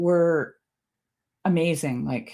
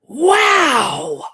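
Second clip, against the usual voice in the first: amazed parrot shout; 11 semitones higher, 7 dB louder than usual. Retold or imitated. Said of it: "he stretches himself right out and he goes".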